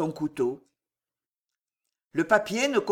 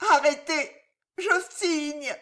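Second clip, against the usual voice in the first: rattled gasp; 8 semitones higher than usual. Acted. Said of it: "râla la martyre".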